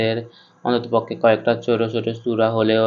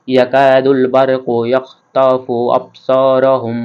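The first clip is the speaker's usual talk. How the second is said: slow chanted verse, a touch louder; slow recitation; 2 semitones higher, 5 dB louder, much slower than usual. Shown as a demonstration. Teacher reciting the verse for students to follow. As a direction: recite the verse slowly and fully. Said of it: "আপনারা আমার সাথে সাথে পড়ুন".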